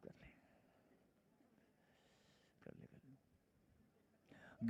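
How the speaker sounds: slow breathless whisper. Shown as a demonstration. Preacher shows saying it silently in your mind, whispered. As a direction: barely mouth the words to yourself. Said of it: "आपको मन में बोलना है".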